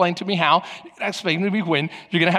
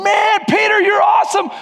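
high, loud hyped-up shout; crowd cheering; 14 semitones higher, 9 dB louder than usual. Acted. Twelve disciples cheering Peter on from the boat, high excited shout. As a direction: yell it like an over-excited crowd. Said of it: "That's the twelve going".

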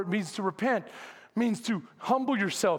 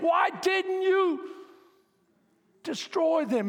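whiny complaint; 8 semitones higher, slower than usual. Acted. Jonah complaining to God.

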